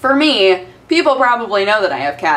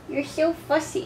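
a bit higher soft coo; 7 semitones higher, 10 dB quieter than usual. Real, not acted. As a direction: coo it teasingly to the cat. Cooing to the cat.

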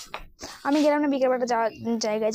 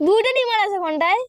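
loud; 9 semitones higher, 9 dB louder than usual. Real, not acted. Pressing brother for fish.

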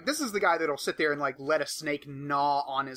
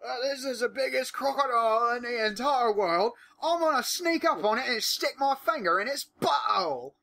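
much higher, excited wildlife host voice; mock Steve Irwin; 8 semitones higher, 3 dB louder than usual. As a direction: ham up an eager croc hunter announcing a stunt. Spoofing Irwin crocodile stunt.

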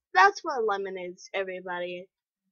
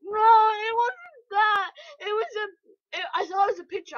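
shaken; 7 semitones higher, 5 dB louder than usual. Real, not acted. Shaken after screaming.

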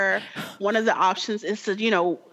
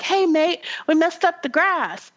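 put-on British accent; 8 semitones higher than usual. Player retelling the game hero leaving his tank, mock British. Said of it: "that's my British accent".

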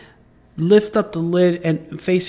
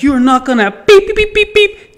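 loud, higher bleeped cursing; defiant shout; 12 semitones higher, 6 dB louder than usual. Retold or imitated. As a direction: yell the bleeps in a rising fury. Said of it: "I swore and I spoke to the damn thing".

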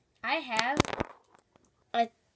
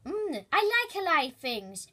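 ordinary delivery

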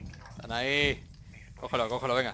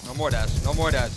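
urgent; 9 dB louder than usual. Real, not acted. Pleading with dying teammate.

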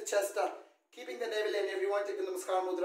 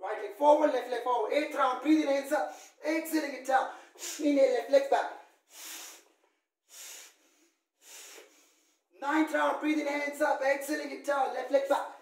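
called yoga cues; 5 semitones higher than usual. Acted. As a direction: call each step out over the room. Calls sun salutation rounds.

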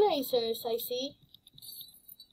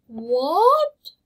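shocked what; 3 semitones higher, 10 dB louder than usual. Real, not acted. Shock at the game screen.

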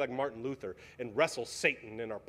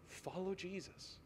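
soft, near-breathless appeal, much softer; hushed plea; 4 semitones higher, 12 dB quieter than usual. Real, not acted. Pastor pleading with his congregation, hushed and soft. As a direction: lean in and plead quietly. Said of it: "I plead with you".

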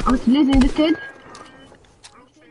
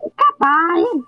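drawn-out taunt; 3 semitones higher, much slower than usual. Pretend. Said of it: "repeats the taunt drawn out".

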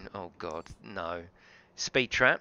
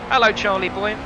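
loud greeting; 6 semitones higher, 8 dB louder than usual. Real, not acted. Greeting chat viewer on stream.

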